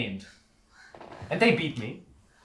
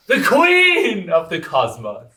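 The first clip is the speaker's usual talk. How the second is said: loud, slow, grand announcement; proud correction; 4 semitones higher, 6 dB louder, much slower than usual. Acted. Performer loudly correcting the showman's barker cry.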